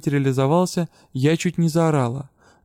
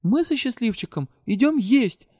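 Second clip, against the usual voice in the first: higher, decided and final; 8 semitones higher than usual. A narrator's reading. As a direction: announce it firmly. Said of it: "решила я". (решительно объявила)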